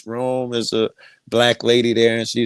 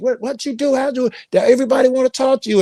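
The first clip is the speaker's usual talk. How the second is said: much higher, fast and excited; amazed sister; 12 semitones higher than usual. Retold or imitated. Sister demanding to know what he did, fast and much higher. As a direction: rattle it off as the stunned sister. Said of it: "my sister's like".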